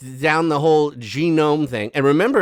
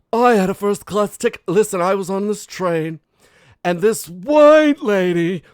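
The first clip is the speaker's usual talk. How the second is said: mock snooty lady; 6 semitones higher, 5 dB louder than usual. Mocking a woman's first class complaint.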